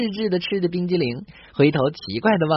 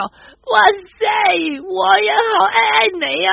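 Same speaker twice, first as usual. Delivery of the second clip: much higher, louder squeal of delight; surprised shriek; 13 semitones higher, 4 dB louder than usual. Acted. A Cantonese girl answers her boyfriend's declaration with a higher, louder squeal. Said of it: "女孩子惊叫".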